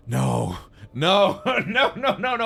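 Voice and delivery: laughing no no no, rising high; half-laughing denial at the bad news; laughing disbelief. Telling the pizza clerk, laughing disbelief. Shocked reaction to discontinued pizza.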